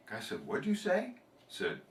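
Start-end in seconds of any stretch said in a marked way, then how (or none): 0.5-1.1 much higher, startled question; disbelieving, can't believe his ears; shocked what. Acted. Customer reacts to the parrot's insult, shocked and high.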